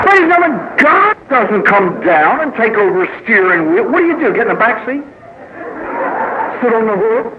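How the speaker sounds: high-pitched incredulous appeal; comic exasperation. Acted. Speaker scolding audience about letting God steer, comic exasperation. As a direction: plead with the room in high disbelief.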